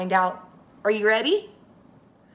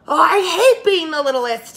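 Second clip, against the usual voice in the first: put-on much higher, loud, fed-up child's cry; exasperated wail; 7 semitones higher, 9 dB louder than usual. Little Hannah to Grandpa, loud exasperated wail. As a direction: wail it like a frustrated little girl.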